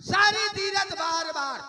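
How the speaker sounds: high, shouted proverb; ringing stage recitation; loud declaiming. Acted. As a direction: proclaim the saying to the whole crowd. Recites saying to the crowd.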